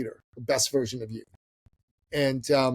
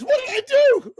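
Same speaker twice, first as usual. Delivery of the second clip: very high, loud comic shriek; mock male panic; 8 dB louder than usual. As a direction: wail it in exaggerated helpless panic. Every man does when a woman cries.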